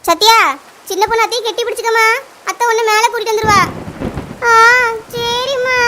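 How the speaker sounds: loud shout across the water; calling out. Acted. Mother shouting across the flood to her daughter and friend, loud. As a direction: shout to them from afar.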